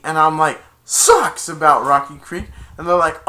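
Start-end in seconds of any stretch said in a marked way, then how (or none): 0.8-1.5 high, loud one-word heckle; heckling shout. Retold or imitated. Fan heckling the Rocky Creek chant, loud jeer. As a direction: yell it over the chant.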